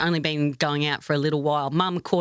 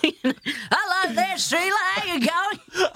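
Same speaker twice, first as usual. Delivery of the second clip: very high, slow Aussie bar greeting; old club barfly; 11 semitones higher, slower than usual. Acted. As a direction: stretch it out like an old regular ordering. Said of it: "someone that's up at the bar".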